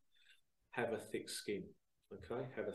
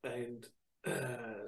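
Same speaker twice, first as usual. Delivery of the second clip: usual speaking voice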